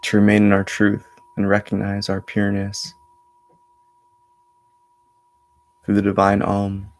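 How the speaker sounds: measured meditation voice with long pauses; steady, level tone, resting between phrases; guided meditation. Acted. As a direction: guide them inward in an even, unhurried flow. Leads a meditation.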